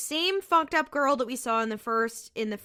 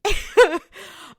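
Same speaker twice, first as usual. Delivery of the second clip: loud burst of laughter; 9 semitones higher, 11 dB louder than usual. Real, not acted. Laughing at the exchange.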